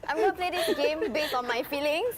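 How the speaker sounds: giggling through the words; laughing. Real, not acted. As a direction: laugh while you say it. Giggling while accusing a player.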